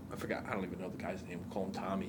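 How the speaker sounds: ordinary delivery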